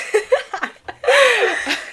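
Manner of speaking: bursting out laughing; high cackling laugh; loud high laugh. Real, not acted. Friend laughs at the accent.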